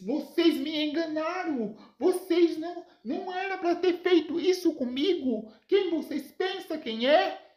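high-pitched indignant outburst; acted fury, high and accusing; furious. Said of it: "fica furioso e diz".

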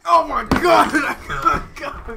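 loud pained laughing; strained laughing cry. Real, not acted. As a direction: laugh through the burn. Mouth full of wasabi.

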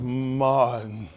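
lightly mimicked wife, low and drawn out; Coretta. Coretta calling her husband, low drawn-out impression. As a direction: throw the name out long and low. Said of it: "It's the way she throw Martin's name".

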